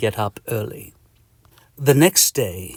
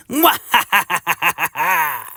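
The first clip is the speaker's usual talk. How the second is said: high, drawn-out mwahaha; hammed-up villain cackle; evil laugh; 7 semitones higher, 3 dB louder than usual. Patient plots revenge on the itch, evil laugh.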